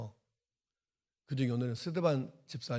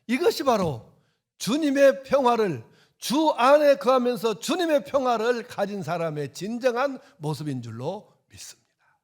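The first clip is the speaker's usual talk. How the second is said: loud, much higher, slowing on the last phrase; full-force preaching; 8 semitones higher, 11 dB louder than usual. Acted. Pastor exalting Stephen's peace to the congregation, fervent. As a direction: build it into a soaring climax.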